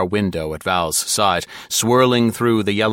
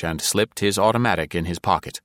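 usual speaking voice

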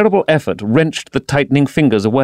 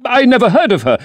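much higher, desperate denial; panicked denial; 12 semitones higher, 3 dB louder, faster than usual. A narrator's reading. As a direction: deny it in a rising panic.

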